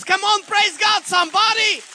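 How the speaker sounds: loud, high preacher's call; preaching at full force. Real, not acted. Preacher urging the congregation to praise, full-force call. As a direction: rouse the crowd to praise.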